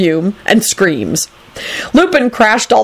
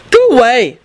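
raised-pitch brush-off; rude; 6 semitones higher, 4 dB louder than usual. A narrator's reading. Neville dismissing Dragomir, rude. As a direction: dismiss him rudely. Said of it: "told him rudely".

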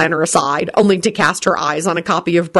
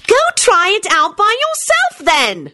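high, slow shouted retort; shouting; slower than usual. Acted. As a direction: shout it at him. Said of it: "shouted Solange".

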